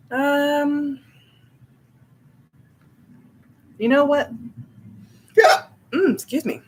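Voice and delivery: hiccuping between words; with hiccups. Real, not acted. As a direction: talk through hiccups. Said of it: "hiccups are unfortunately a thing".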